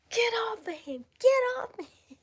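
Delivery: very high, loud screech; frantic yell. Retold or imitated. Speaker yelling at the snake to free the toad, frantic. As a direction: re-enact screaming at an attacker.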